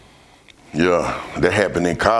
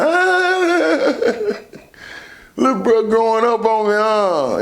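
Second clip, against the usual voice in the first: high laughing delight; 13 semitones higher, slower than usual. Real, not acted. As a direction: laugh through the words. Laughing at brother smoking.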